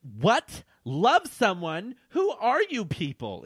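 high, drawn-out indignant yell; acted outcry, slow and rising; mock yell. A narrator's reading. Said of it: "Elaine yelled".

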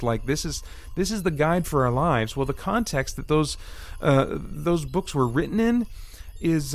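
usual speaking voice

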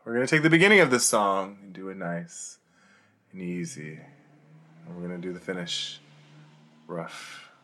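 low, soft stage monologue; quiet, teasing musical intro; spoken song intro. Acted. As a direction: set up the song in a hushed voice. Recites Proud Mary spoken intro.

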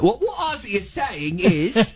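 put-on light Cockney accent, slow; a hint of Cockney. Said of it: "that Cockney accent ready to go".

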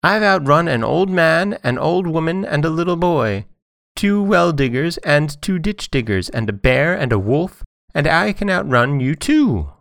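high-pitched boastful call; Johnny Cake calling out his brag. A narrator's reading. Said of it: "called out in a very loud voice".

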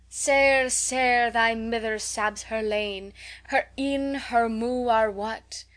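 slow, drawn-out Scots lament; mock Scots recitation. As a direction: recite dolefully in broad Scots, stretching the words. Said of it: "A Scotch Poem".

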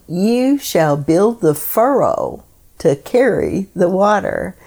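ordinary delivery

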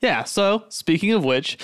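usual speaking voice

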